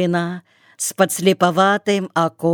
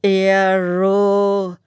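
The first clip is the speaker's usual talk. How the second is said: whiny singsong; 2 semitones higher than usual. A narrator's reading. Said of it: "плаксиво пропел".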